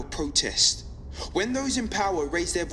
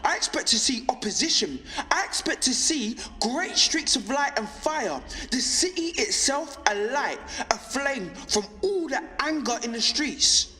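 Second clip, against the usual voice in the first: rising, fervent high-pitched rallying speech; impassioned; 4 semitones higher than usual. Acted. Broadcaster picturing the uprising they expected in the streets, impassioned. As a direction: build insistently through each repeated expectation to a fiery peak.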